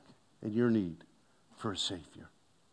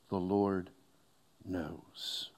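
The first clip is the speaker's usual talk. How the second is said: quiet quote; 6 semitones lower, 8 dB quieter, much slower than usual. Acted. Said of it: "Just as Nahum says".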